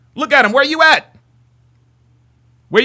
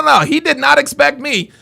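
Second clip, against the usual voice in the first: ordinary talk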